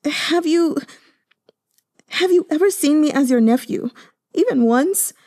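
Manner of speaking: high, broken tearful question; sobbing. Acted. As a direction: ask through sobs. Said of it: "Yue Chunqing sobbed".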